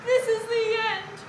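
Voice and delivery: high, loud cry of doom; storybook character crying out in panic; alarmed cry. A narrator's reading. Basil being bound to the vine, alarmed cry. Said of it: "cried Basil".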